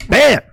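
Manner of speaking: sharp, loud, high "Bam!" like an impact; crash sound. Pretend. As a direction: slam the word out as the car hits. Sudden crash in the story.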